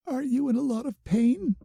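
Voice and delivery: high, anxious question; worried girl. A narrator's reading. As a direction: fret over his pain. Bloom worried over him.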